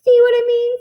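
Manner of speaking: triumphant witch squeal; high delighted witch. Acted. Witch gloating at the dog's new shape, high and gleeful.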